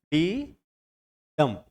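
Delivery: soft "डी", pause, stronger "डंप"; two separated beats, weight on "डंप"; stress on second beat. Shown as a demonstration. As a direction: demonstrate weak "डी" then stressed "डंप". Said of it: "डंप पे ज्यादा है".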